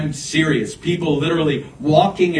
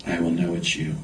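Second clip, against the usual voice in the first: hushed, near whisper; 8 semitones lower, 7 dB quieter than usual. Retold or imitated. Retelling his dorm-room prayer to God, hushed. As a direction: drop very low and soft. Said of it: "even when you whisper".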